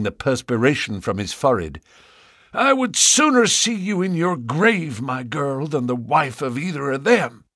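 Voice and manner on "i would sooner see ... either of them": put-on angry old farmer. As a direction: bark at the unwelcome suitors. Ferrier's voice to Lucy.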